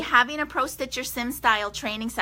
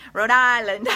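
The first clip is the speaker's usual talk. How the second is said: "Rhode Island" said in a put-on New England accent, a touch louder; 5 dB louder than usual. Shown as a demonstration. Naming her friend's home state in a light New England accent. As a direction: try the Rhode Island way of saying "Rhode Island". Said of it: "Oh, with the accent".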